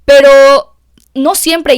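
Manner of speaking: "pero" stretched slow and loud, then "no siempre" falling; slow emphatic pero. Real, not acted. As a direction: lean hard on "pero", drawn out. Advice with a caveat. (advertencia lenta y enfática en "pero")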